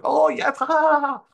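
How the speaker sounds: high, loud, hammed-up fake cheer; mocking fake laugh. Mocking the nodding auditor, high fake laughter. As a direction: squeal out a phony agreeable laugh. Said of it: "And the guy's like".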